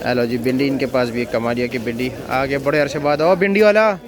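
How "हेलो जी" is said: normal talking voice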